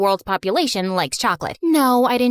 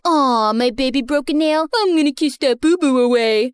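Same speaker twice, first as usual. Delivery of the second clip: mock baby talk. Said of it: "this couple started baby talking".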